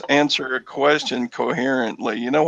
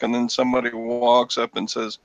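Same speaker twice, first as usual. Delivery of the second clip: normal talking voice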